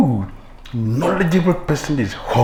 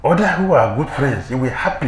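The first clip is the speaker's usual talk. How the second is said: ordinary talk, nothing marked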